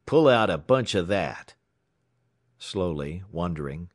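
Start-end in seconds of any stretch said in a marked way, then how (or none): 0.1-1.5 louder rough command; gruff order. A narrator's reading. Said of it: "he ordered".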